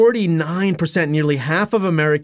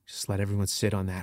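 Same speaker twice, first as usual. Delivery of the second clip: ordinary talk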